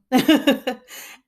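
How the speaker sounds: loud high laugh. Real, not acted. Laughing at herself while scrolling for the page, loud laugh.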